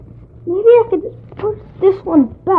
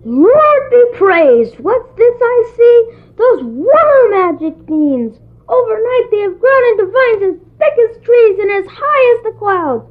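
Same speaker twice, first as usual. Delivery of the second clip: amazed excitement; 6 semitones higher than usual. Acted. Jack finds the beanstalk at dawn and calls his mother, thrilled. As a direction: marvel at the vines and call out.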